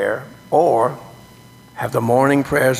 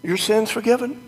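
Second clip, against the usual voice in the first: seraph; 6 semitones higher than usual. Pretend. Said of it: "like the seraph".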